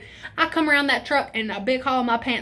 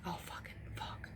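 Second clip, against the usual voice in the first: breathless whispered curse; panicked whisper; 21 dB quieter than usual. Retold or imitated. Teen whispers in panic as friend greets the cop. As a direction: hiss it under your breath in dread. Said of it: "I was like".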